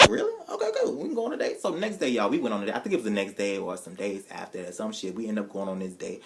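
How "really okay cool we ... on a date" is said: high giddy relief. Retold or imitated. High giddy yes to Harley. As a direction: brighten up instantly.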